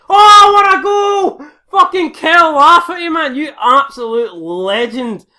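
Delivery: screaming a last-minute winner; long goal yell. Real, not acted. Late winning goal celebration.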